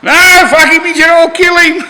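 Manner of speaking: loud, high wild-man voice; mock crazed refusal. Irish answering his mates, a loud high mad refusal. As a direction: play Irish, defiant and mad.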